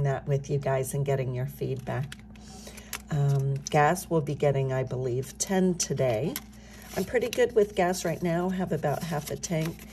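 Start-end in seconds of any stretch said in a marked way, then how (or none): none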